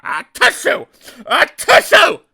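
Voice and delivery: very high, loud sneezes; sneezing. Acted. Pig sneezing after nose tickle, loud. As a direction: burst the words out as a sneeze. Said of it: "sneezed the pig".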